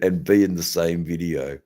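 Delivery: ordinary talk, nothing marked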